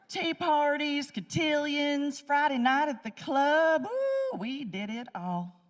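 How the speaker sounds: high, slow Southern belle voice; prissy Southern belle act. Retold or imitated.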